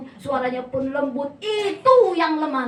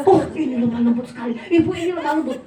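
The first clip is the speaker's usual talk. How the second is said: amused mock praise; 5 dB louder, faster than usual. Mocking bystander comment.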